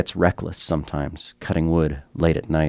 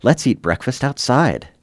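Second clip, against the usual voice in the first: lightly imitated cheery mother, pitched up; a hint of chirpy, motherly enthusiasm; 6 semitones higher than usual. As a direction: lift the pitch into a mom's cheerful call. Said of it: "her cheery, motherly voice saying".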